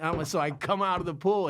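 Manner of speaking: usual speaking voice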